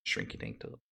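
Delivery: whispered aside; hushed, unvoiced aside. Real, not acted. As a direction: drop to a whisper.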